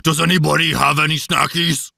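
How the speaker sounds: growly monster puppet voice; Cookie Monster. Acted. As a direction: beg for snacks as a hungry puppet monster. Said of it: "we can all do Cookie Monster".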